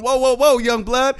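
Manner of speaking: a lighter loud call of whoa whoa; quick replay of the elder's warning; mimicking the old man.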